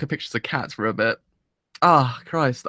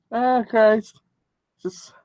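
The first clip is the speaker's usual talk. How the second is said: tearful; 7 semitones higher, much slower than usual. Real, not acted. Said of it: "I'm crying".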